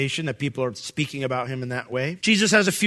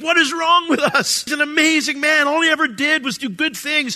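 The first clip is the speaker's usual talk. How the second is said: exasperated; 12 semitones higher, 6 dB louder than usual. Retold or imitated. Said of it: "you just think".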